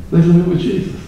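usual speaking voice

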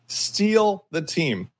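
steal the team, drawn out slowly; slow emphatic repeat. Real, not acted. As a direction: lean hard on steal.

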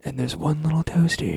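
sultry voice. Pretend. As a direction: try to sound seductive, half-laughing. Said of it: "in a sultry voice".

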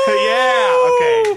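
full-throated howl into the mic; wolf howl. Real, not acted. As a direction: howl like a wolf, very high and loud. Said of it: "the most courageous here tonight".

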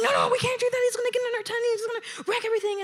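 high-pitched rapid protest; mock panic. Boyfriend fretting about his tent, mock panic. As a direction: imitate a frantic, whiny objection.

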